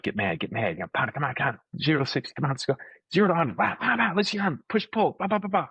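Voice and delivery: coaching hype. Retold or imitated. Coach pumping up his student before the break, rising excitedly.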